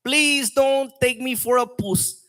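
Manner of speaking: put-on high, slow South African accent; sing-song South African rapper impression. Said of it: "a South African rapper".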